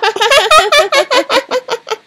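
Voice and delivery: high, spiteful cackle; mean laugh. Acted. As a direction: cackle at the nickname. Stepsister mocks new name.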